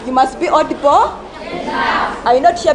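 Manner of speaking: ordinary delivery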